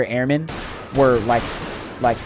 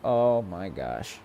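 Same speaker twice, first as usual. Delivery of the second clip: slow, low, softer weary sigh of words; exasperated; 6 semitones lower, 8 dB quieter, much slower than usual. Retold or imitated. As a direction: play it tired and unimpressed. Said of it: "And I'm like".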